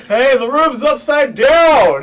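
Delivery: high, loud, drawn-out yell; calling out. Acted. Player announcing reversed gravity to the party, drawn-out shout. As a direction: holler it across the room. Character calls out the upturned room.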